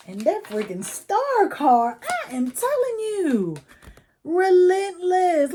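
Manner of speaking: high, loud, drawn-out exasperation; exasperated outburst. Real, not acted. Reader fed up with the recurring star card, loud exasperated wail. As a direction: cry out at the card that won't leave.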